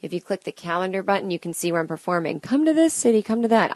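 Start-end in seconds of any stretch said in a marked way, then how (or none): 2.4-3.8 high, louder mock pleading; imitating demanding fans. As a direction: mimic fans clamoring for a visit. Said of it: "I know you're saying that".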